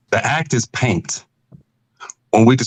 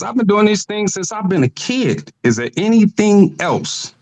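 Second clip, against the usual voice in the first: put-on eager young man; 9 semitones higher than usual. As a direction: voice jumps up high and slows for the question. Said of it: "The man says".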